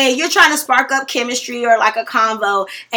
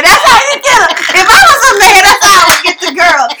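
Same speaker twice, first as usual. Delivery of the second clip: higher and louder, playful and laughing; excited, laughing advice; excited tip; 8 semitones higher, 8 dB louder than usual. Real, not acted. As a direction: sell the trick with a grin.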